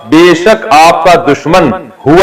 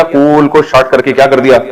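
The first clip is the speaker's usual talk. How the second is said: usual speaking voice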